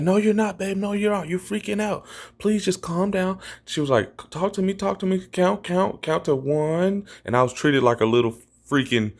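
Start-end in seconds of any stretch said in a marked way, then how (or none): 0.0-3.4 high-pitched girlfriend imitation; imitated pleading girlfriend. Girlfriend calming him in the cold shower, imitated pleading. 4.3-7.1 put-on high-pitched, urging girlfriend voice; imitated coaxing girlfriend. Girlfriend keeping him talking as he freaks out, imitated coaxing.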